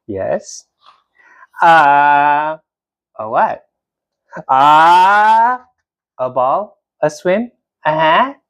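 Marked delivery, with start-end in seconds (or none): none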